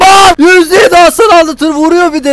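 high, very loud enraged yell; real rage at full volume; furious screaming. Real, not acted. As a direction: scream abuse at whoever hit you. Rammed, raging at the driver.

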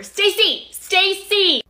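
loud, high call for someone; yelling. Acted. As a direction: holler the name across the shop. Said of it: "please stop yelling".

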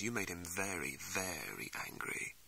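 ominous hush. Acted. Game Cat warning of the general's anger, ominous hush.

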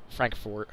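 "Frankfort" with an OR in the second syllable; Frankfort as "Frank-fort". Shown as a demonstration. Tracing the town's name, "Frankfort" said FRANK-fort. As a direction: say "Frankfort" with a clear "fort" ending. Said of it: "eventually just became".

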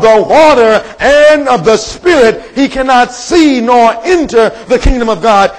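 slow, high scripture proclamation; emphatic preaching. Acted. Jesus telling Nicodemus straight, emphatic preaching. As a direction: draw out each phrase with rising force.

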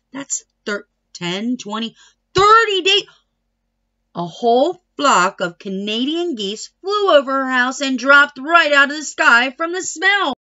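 ordinary delivery

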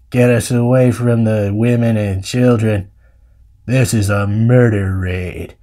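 low, slow, deliberate cowboy drawl; John Wayne. Acted. Uncle realizing they were lured away, low slow drawl. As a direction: spell out the grim news in a deep drawl. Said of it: "doing an impression of John Wayne through my stupid cowboy".

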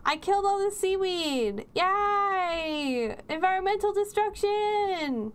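high, drawn-out gleeful cheer; mock celebration. Gleeful mock celebration of wrecking the sea.

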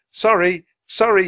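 high, loud frantic apology; yelled apology. A narrator's reading. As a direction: shout sorry over a commotion. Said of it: "yelled Jack".

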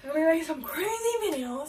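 loud, hyped-up shout; excited shout. Real, not acted. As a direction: yell it like a vlog hype intro. Hyped vlog intro shout.

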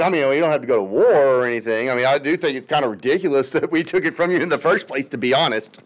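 lightly voiced timid Britain and France; sheepish mock appeasing. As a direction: rush it out like a nervous apology. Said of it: "they were like".

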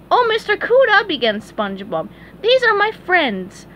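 squeaky cheerful cartoon sponge; eager sponge. A narrator's reading. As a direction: show off his pals. SpongeBob voiced.

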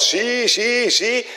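a hint of a mocking listener; mocking the preacher. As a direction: parody the preacher's zie. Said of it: "Moet je hem nou horen".

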